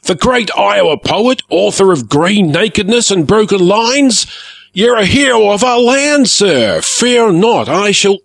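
excited. A narrator's reading. Pirate gushing over a famous poet.

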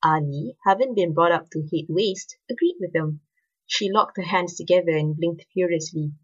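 plain reading voice

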